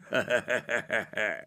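menacing character chuckle; wolfish laugh. A narrator's reading. Wolf leaving Petya with a threat, villainous laughing.

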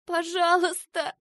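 high, drawn-out plea; begging. A narrator's reading. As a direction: implore like a desperate child. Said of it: "взмолилась Ася".

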